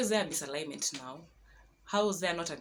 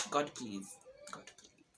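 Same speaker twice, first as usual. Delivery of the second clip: desperate prayer trailing off into a whisper; frightened plea fading away; 4 semitones lower, 7 dB quieter than usual. Retold or imitated. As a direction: beg God, quieter each time, until only breath is left. Retold prayer to God.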